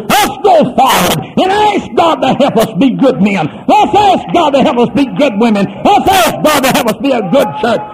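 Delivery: high, loud shouted altar call; hollered revival appeal; altar call preaching. Acted. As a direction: call them forward with full force. Altar call preaching at full force.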